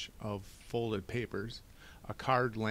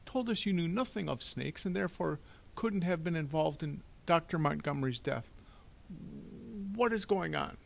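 high-pitched banker, puzzled and demanding; fussy official wanting answers, pitched up; flustered Mister Poe, baffled; 8 semitones higher than usual. Acted. Banker questioning Stefano about the death, flustered and baffled.

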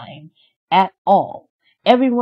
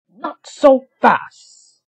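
higher, slow and louder, defiant fairy; stubborn cry; 6 semitones higher, 5 dB louder, slower than usual. Acted. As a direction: draw it out, daring her to try. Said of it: "Merryweather cried".

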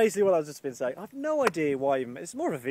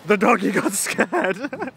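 loud, higher, drawn-out cooing with a laugh; teasing the dog; 5 semitones higher, 9 dB louder, much slower than usual. Real, not acted. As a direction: coo mockingly at a scared doggy.

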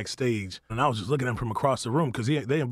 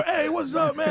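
much higher, bright eager hello; eager greeting. Retold or imitated. Guest acting out the greeting he expected from Kevin. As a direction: imitate yourself hoping for a friendly greeting. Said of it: "Like, for".

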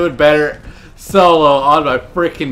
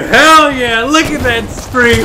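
ordinary delivery

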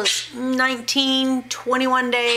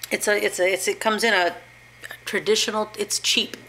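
ordinary delivery

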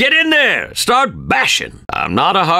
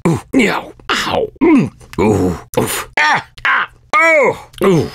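string of ows and oofs as blows land; cartoon pained ooh and ow cries; pained yelps. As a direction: yelp and groan with each hit. Instructor getting hit repeatedly.

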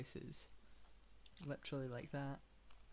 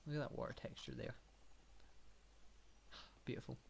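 understated mock admiration; deadpan sarcasm, with a sigh before the last word; sarcastic; 4 dB quieter than usual. Real, not acted. Player sarcastically admiring the game's water texture, dry mock awe. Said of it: "I'm being sarcastic".

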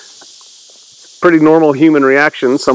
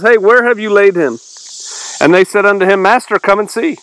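raised, measured Bible-reading voice; higher-pitched, deliberate recitation; scripture reading; 5 semitones higher, faster than usual. Acted. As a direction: read the verse out to a study group. Reads scripture aloud.

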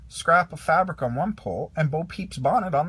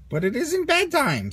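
much higher whiny protest; Rex protesting; 12 semitones higher, 4 dB louder than usual. A narrator's reading. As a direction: object up high like a worried dinosaur. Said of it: "said Rex".

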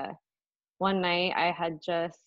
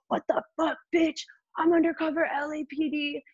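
imitated angry shout, pitched up; raging; 9 semitones higher than usual. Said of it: "is, like, raging".

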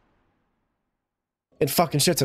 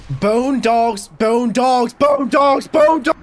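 climbing, ever louder alarm yells; panicked shouting; 10 semitones higher, 7 dB louder, much slower than usual. Real, not acted. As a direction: shout it higher each time in rising panic. Panics at dreaded enemies.

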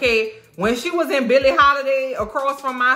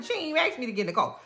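ordinary talk

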